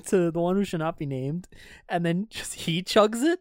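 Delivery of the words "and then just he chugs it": normal talking voice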